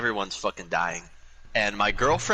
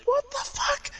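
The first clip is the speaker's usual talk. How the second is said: very high squeal through laughter; high laughing what the fuck; laughing disbelief; slower than usual. Real, not acted. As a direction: squeak it out amazed. Shocked reaction while laughing.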